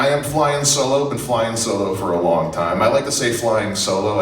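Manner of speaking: ordinary talk, nothing marked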